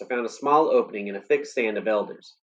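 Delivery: ordinary delivery, nothing marked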